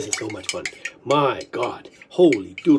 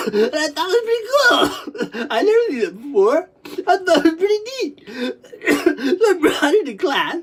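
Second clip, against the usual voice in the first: high, choked-up voice breaking into coughs; tearful retelling; 6 semitones higher than usual. Retold or imitated. Recalling bringing it to first grade class, tearful. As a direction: tell it through rising sobs.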